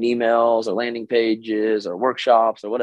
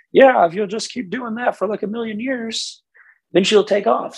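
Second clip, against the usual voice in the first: much higher caricature voice; mock coach persona; 11 semitones higher than usual. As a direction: parody a guru giving hopeless advice. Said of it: "my little personalities aren't very good".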